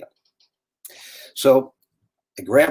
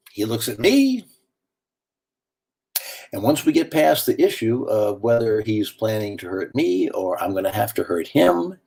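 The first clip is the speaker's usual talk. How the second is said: ordinary delivery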